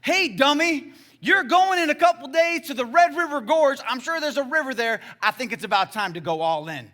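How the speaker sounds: high, loud, blunt pronouncement; God speaking out loud. Retold or imitated. God tells a man to go all in, loud and blunt. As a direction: call him out at full volume, easing down at the end. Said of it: "he spoke out loud and said".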